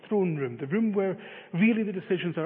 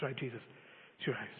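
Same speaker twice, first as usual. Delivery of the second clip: much softer, lower resigned handover; quiet surrender; 5 semitones lower, 14 dB quieter, faster than usual. Retold or imitated. Man handing the keys to Jesus, softly resigned. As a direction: give it up gently, finally.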